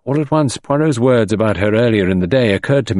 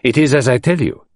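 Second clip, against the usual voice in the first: put-on emphatic, higher French-accented affirmation; Poirot; 6 semitones higher than usual. A narrator's reading. Detective confirming to his surprised friend, emphatic. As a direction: insist it is so, pitch lifted. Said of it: "he nodded emphatically".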